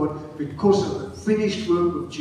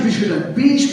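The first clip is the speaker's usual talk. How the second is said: ordinary delivery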